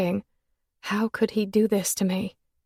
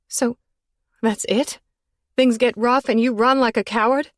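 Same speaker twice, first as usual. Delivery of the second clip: higher, louder accusing; choked accusation; 4 semitones higher, 5 dB louder than usual. Acted. Woman accusing lover of cowardice. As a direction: throw the accusation at him. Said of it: "I choked around the accusation".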